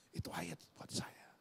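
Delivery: softly whispered repeat, no voice. Retold or imitated. Pastor recalling the verse, whispered to himself. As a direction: breathe the words to yourself in awe. Repeats his own realisation.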